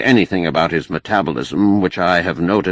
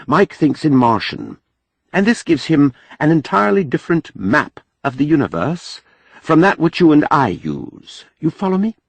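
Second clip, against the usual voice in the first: a hint of a higher, careful foreign scholar; lightly voiced Mahmud, earnest; 9 semitones higher than usual. A narrator's reading. Mahmud cautions Jubal not to joke with Mike, earnest. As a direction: voice Mahmud a touch higher, warning gently. Mahmud explains Martian thinking.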